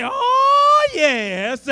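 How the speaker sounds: high shouted oh then falling yes. Retold or imitated. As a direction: cry out like a sister in the pews. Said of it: "somebody would say".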